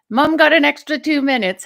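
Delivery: loud, high, quick playful announcement; calling out. Retold or imitated. Keeps up the called-out announcement.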